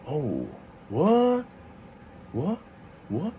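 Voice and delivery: acted doubt, each "what" rising sharply; suspicious second tone. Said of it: "you're kinda suspicious".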